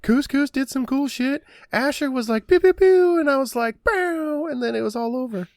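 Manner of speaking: put-on high, bragging kid voice; cocky teen boaster. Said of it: "just be like".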